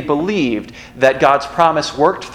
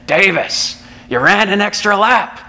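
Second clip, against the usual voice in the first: coach's raspy voice; 6 semitones higher than usual. Retold or imitated. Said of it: "he had this raspy voice".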